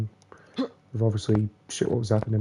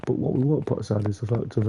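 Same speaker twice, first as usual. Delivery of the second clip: normal talking voice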